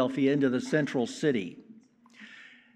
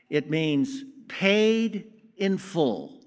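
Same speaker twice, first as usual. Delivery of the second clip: slow and weighty; 2 semitones higher, much slower than usual. Acted. Defining tetelestai slowly.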